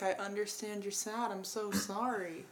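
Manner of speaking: quiet, gentle comforting words; soft sympathy. Acted. Comforting a sad friend, soft and tender. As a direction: hush down and console a sad friend. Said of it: "sit with someone and be like".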